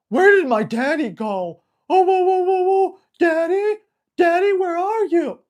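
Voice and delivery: high, loud whining puppy calling for daddy; frantic pup crying out; panicking puppy voice. Retold or imitated. Trainer voicing a puppy missing its owner, high and loud. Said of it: "He's carefree".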